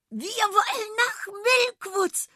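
high, loud squeak; squeal of delight. A narrator's reading. Sausi cheers for Milkwoods, squealing with delight. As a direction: squeak it with glee. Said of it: "quiekte Sausi vor Vergnügen".

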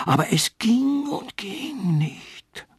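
slow, high plaintive tree; lamenting. A narrator's reading. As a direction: wail it out slowly, defeated. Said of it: "klagte der Götterbaum".

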